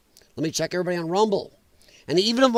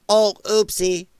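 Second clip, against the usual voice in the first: mock oopsie; slower than usual. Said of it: "Then admits later".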